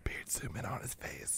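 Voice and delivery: hushed aside to the booth; whisper. Real, not acted. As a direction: whisper it behind his back.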